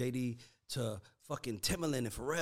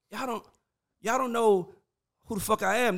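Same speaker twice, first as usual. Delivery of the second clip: mock angry, higher and louder; imitating pissed off; 7 semitones higher, 6 dB louder than usual. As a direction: act out a producer who's mad at being forgotten. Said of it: "you just came back kinda pissed".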